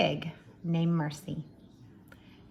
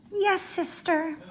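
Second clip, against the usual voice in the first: much higher, meek little sister; timid Baby voice; 10 semitones higher than usual. Acted. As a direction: pipe up high like a meek younger sister. Baby voiced in read-aloud.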